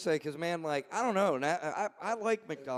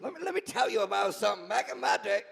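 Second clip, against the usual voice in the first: hammed-up old-timer, higher and hurried; rushed, pitched-up mock reminiscing; co-host impression; 3 semitones higher, faster than usual. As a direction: tease your co-host with a quick back-in-my-day impression. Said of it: "that was my impression of you".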